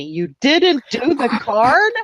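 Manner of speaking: teasing slow holler after a laugh; mock yell. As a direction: imitate a friend yelling, slowly stretched. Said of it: "Tom's yelling at me".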